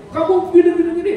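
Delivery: hammed-up nagging outburst; a mock angry wife lashing out. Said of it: "Kalau ibu pakai senjata laki-laki".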